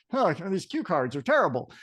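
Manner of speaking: ordinary delivery, nothing marked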